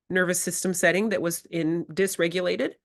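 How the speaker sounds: ordinary talk, nothing marked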